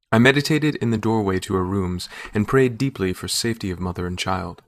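ordinary delivery, nothing marked

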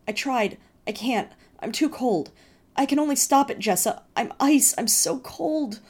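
high, loud despairing cry, slowing; desperate cry. Acted. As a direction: cry out, lost and freezing. Freezing brother despairs.

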